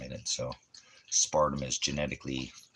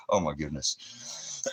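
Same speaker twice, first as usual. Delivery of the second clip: ordinary talk, nothing marked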